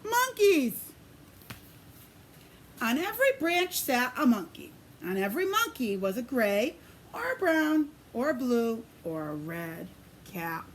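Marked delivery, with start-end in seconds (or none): 0.0-0.8 high, loud exclamation; spring the surprise on the listeners; big gasp of a reveal; surprised reveal. A narrator's reading. 9.0-10.7 much lower and softer; soft, low confiding aside; hushed. A narrator's reading.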